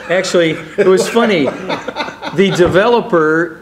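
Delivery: talking through real laughter; laughing. Real, not acted. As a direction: laugh as you tell it. Laughing at own story.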